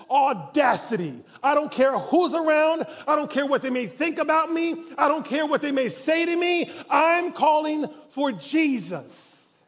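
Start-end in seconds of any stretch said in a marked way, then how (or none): 1.4-9.5 loud, higher, determined; bold defiant declaration. Acted. Blind man not caring what the crowd thinks, loud and bold. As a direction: refuse to be shushed.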